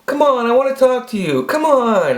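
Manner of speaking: high, imitated female plea; high-pitched pleading woman. Woman begging the hiding man to talk, high mock plea. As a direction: coax him in a put-on high voice. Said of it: "all of a sudden I hear".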